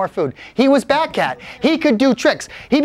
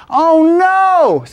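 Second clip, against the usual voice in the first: loud, high, drawn-out cry of dismay; acted alarm; 10 semitones higher, 6 dB louder than usual. Jacob realizing he forgot the weight, loud dismay. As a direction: cry out in sudden horror.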